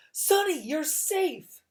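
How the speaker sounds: high, slow joyful cry; relieved cry. A narrator's reading. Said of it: "Klaus cried".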